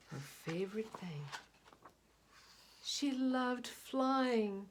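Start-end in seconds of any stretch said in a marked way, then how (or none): 2.8-4.7 delighted. A narrator's reading. Storyteller savours the joy.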